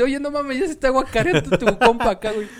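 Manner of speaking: high, loud street vendor calling out. Retold or imitated. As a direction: shout it like the burger guy. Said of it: "el don despachando y dice".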